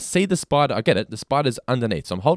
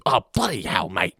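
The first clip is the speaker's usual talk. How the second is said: mock-angry, exaggerated Aussie accent; angry Australian. Mocking American actors' bad Australian accents, angry Aussie. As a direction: do an irate movie Aussie. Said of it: "they're always angry".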